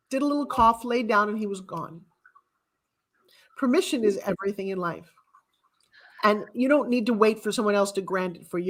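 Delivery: ordinary talk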